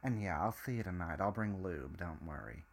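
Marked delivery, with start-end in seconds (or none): none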